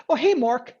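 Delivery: eager salesman's chirp; upbeat voicemail opener; happy upbeat tone. Shown as a demonstration. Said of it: "listen to the tone, happy, upbeat".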